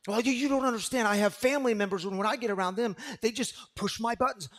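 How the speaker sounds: whiny complaint. Retold or imitated. Mocking a person who says triggered.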